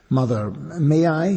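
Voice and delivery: high, slow and polite request. A narrator's reading. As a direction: ask sweetly, slowly. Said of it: "he asked politely".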